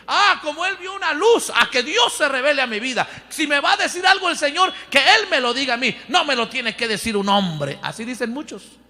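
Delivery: ordinary delivery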